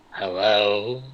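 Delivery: Nevish's voice, lightly copied; a slightly wrong copied voice; mimic voice. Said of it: "in my mimic voice".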